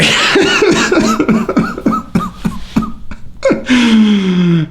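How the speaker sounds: high drawn-out real laugh; long laugh. Real, not acted. Cracking up after mocking Fabia, long laugh. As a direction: laugh hard at your own joke.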